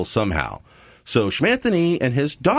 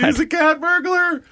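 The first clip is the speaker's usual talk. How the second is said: excited. Real, not acted. Delighted at the cat burglar idea.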